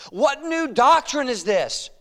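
high, slow, wondering question; amazed crowd. Acted. Preacher reads the crowd questioning among themselves, amazed. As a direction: draw it out in astonishment. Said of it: "were all amazed".

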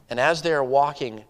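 ordinary talk, nothing marked

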